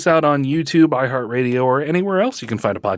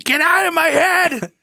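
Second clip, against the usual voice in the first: mock outburst. Joking outburst, high held pitch.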